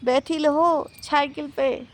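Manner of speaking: high, lisping baby-talk imitation; slow, cutesy toddler mimicry; mimicking bossy little granny. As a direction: teasingly copy the child's baby talk. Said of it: "दादी अम्मा कैसे बोल रही हैं".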